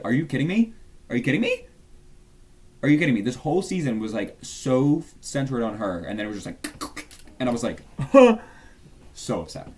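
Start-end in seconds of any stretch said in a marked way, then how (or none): 0.0-3.2 rising incredulous shout, louder each time; are you kidding me, getting louder; mock outrage. Retelling angry reaction to death. 7.9-8.5 high squeal of laughter; shrieking laugh. Real, not acted. Squealing laugh after upset.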